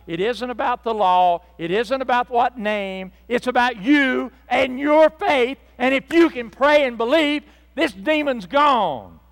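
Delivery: loud, driving pulpit voice building in force; forceful preacher voicing Jesus; emphatic preaching.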